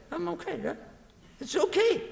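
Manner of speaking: high, breezy mock complacency; smug self-assurance. Believer sure his worship is enough, breezy. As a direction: play the self-satisfied believer.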